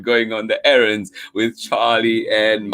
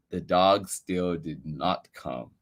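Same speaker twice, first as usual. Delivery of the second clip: ordinary delivery, nothing marked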